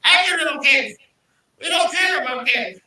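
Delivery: high, loud witch screech; Wicked Witch voice. Pretend. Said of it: "I sound like a Wicked Witch of the West".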